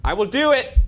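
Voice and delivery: high, loud insistence; defiant warning. Real, not acted. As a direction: back up a threat. Threatening the demon.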